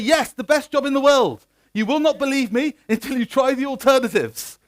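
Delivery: high, loud declaration to the crowd; rousing proclamation. Real, not acted. Hammed-up praise of developer job to crowd.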